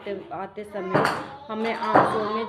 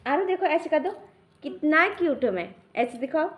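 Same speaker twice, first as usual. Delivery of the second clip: higher, louder cutesy coaxing; 6 semitones higher, 5 dB louder than usual. Real, not acted. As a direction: coax the little one into a cute pose. Coaxing baby to pose cutely.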